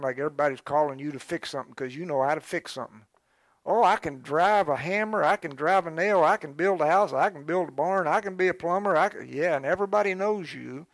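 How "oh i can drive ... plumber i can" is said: loud, high bragging list; boastful handyman. Retold or imitated. Overloaded handyman boasting to callers, loud. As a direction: brag about every job you can do.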